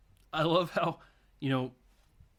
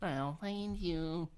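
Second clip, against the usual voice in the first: soft, drawn-out higher lilt; creepy lover; 4 semitones higher, 5 dB quieter, much slower than usual. Retold or imitated. Girlfriend warning her man, slow creepy sing-song. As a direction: stretch each word out slowly and softly. Repeats film's creepy line.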